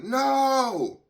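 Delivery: a high, loud, desperate cry of "No!"; retold scream. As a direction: shriek it as the mother did, in panic. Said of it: "I hear a scream, like".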